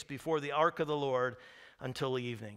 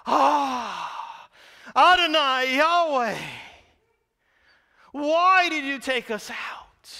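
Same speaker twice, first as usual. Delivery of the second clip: high, loud, slow wailing cry to God; anguished lament; 9 semitones higher, 10 dB louder, slower than usual. Acted. Joshua crying to God after the defeat, anguished wail. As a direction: wail it out in despair. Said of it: "It's like".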